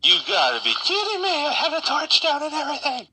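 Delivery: loud, high, fast outburst; exasperated shout. Real, not acted. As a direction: yell it in disbelief. Sudden trouble in the game.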